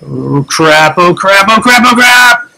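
from muttered oh crap up to high loud panic; rising panic. Real, not acted.

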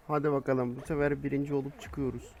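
ordinary delivery

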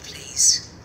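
normal talking voice

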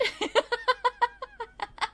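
high, helpless giggle; real tickled laughter; laughing. Real, not acted. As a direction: giggle in a long high run. Cracks up at toddler.

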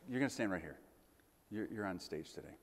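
ordinary talk, nothing marked

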